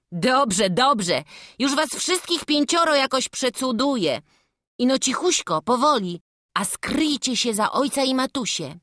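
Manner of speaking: bright eager lad speaking to the family; character voice. Acted. Young church helper inviting the family in, eager.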